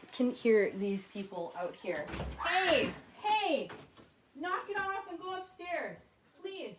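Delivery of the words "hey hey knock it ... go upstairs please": calling upstairs. Real, not acted. Mom calling to kids upstairs.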